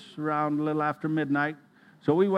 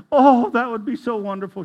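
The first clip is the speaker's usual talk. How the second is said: higher, louder delighted cry of a dying mother; joyful exclamation; 6 semitones higher, 9 dB louder than usual. Retold or imitated. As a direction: let her brighten with hope. Dying mother voiced by son.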